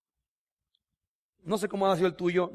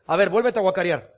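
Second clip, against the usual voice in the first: louder, put-on grudging voice; grudging mock scold; 6 dB louder than usual. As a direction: sneer it like a reluctant forgiver. Mocking a grudging forgiver. (burla de alguien que perdona de mala gana)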